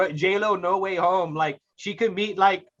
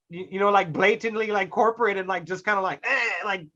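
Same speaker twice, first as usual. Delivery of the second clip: ordinary talk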